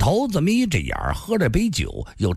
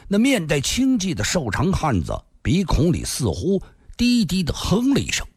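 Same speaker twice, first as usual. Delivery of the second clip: plain reading voice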